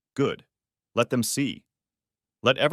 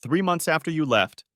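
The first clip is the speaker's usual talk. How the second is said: sobbing; 6 semitones higher than usual. Acted. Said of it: "she sobbed".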